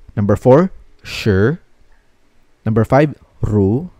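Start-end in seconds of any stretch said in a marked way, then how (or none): 1.0-1.6 "shi" starting low and rising; second tone. Shown as a demonstration. Teacher drilling learners in second tone, "shi" rising. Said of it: "read this in the second tone". 3.4-3.9 "ru" starting low and rising; second tone. Shown as a demonstration. Teacher drilling learners in second tone, "ru" rising. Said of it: "read this in the second tone".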